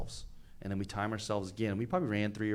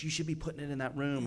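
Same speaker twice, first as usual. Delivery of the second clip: imitating a know-it-all cop; 6 semitones higher than usual. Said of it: "he thinks".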